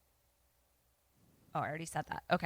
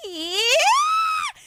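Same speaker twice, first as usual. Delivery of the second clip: squealed, very high, loud yelp; freaking out; 6 dB louder than usual. Retold or imitated. Milton freaking out at the yelling, squealing high. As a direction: yelp like you're startled out of your skin. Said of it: "Milton freaks out".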